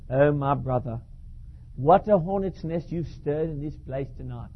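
put-on soft, low English-accented lament; English-accented pastor warning of trouble. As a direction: mutter it like a worried English vicar. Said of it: "Glide's an English chap".

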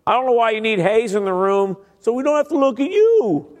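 rising into an indignant squeak; grumbling churchgoer. Retold or imitated. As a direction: huff it like a disgruntled member. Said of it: "They're like".

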